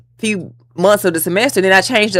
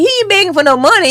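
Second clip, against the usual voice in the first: much higher, delighted; excited inner voice; 13 semitones higher, 3 dB louder, faster than usual. Retold or imitated. Guest recalling her reaction to the professor, high and excited. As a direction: say it pitched way up in admiration. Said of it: "And I'm like".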